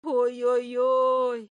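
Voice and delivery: high, long-drawn creature wail; lamenting little familiar; groaning familiar. A narrator's reading. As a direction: moan it out slowly and high. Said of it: "стенула между тем Фая".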